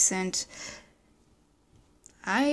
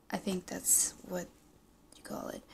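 hushed, unsure whisper under her breath; whispered aside; 12 dB quieter than usual. Real, not acted. Vlogger unsure of the app name, whispering to herself. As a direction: drop to a whisper, half to herself.

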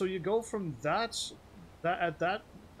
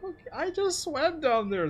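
high, loud and shaken; scared horror game streamer; 10 semitones higher, 8 dB louder, much slower than usual. Real, not acted. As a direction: cry out, rattled by the scare. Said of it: "double jump scare".

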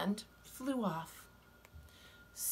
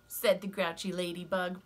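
ordinary delivery, nothing marked

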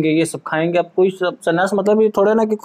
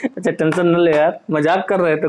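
normal talking voice

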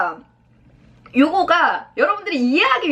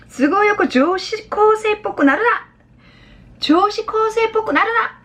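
high, slow, emphatic complaint; acted exasperated girlish protest; scolding daughter; 9 semitones higher, slower than usual. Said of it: "딸이 뭐라고 하냐면".

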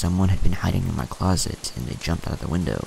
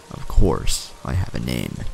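lightly played low monster; a hint of crackly monster, pitched low; deep crackly monster; 7 semitones lower than usual. A narrator's reading. Monster answers the child about its name, lightly deep and crackly. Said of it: "deep and crackly".

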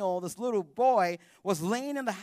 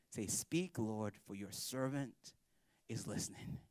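much softer, slow, fading into a whisper; hushed prayer; 9 semitones lower, 14 dB quieter, slower than usual. Retold or imitated. Eli quietly teaches the boy what to say to God, in a hushed whisper. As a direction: breathe the words like a reverent night prayer.

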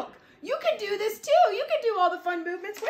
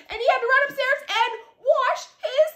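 ordinary delivery, nothing marked

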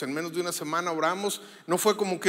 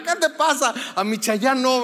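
mock indignant mom; 4 semitones higher, 5 dB louder than usual. Mom scolding the joking daughter.

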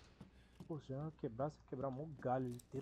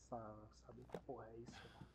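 muttering under breath; 2 semitones lower, 13 dB quieter than usual. Real, not acted. Muttering at unreadable game text.

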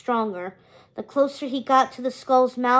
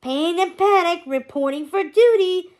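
high, loud reporting for duty; saluting minion; 6 semitones higher, 5 dB louder than usual. A narrator's reading. Panic reports to Hades with a salute, eager minion. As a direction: report eagerly to the boss, pitched up. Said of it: "added with a salute".

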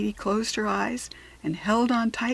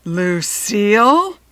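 loud, slow drawn-out name; furious principal calling her name; very angry shout; 9 dB louder, much slower than usual. Acted. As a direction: make her freeze. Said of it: "shouted in a very angry voice".

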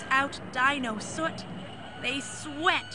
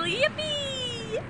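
a very high delighted squeal; squealing yippee. A narrator's reading. Dinos on the roller coaster ride, squealing with glee. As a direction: shriek with roller coaster joy. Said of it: "They dino scream and squeal".

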